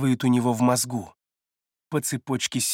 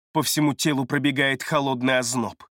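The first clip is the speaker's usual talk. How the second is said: ordinary delivery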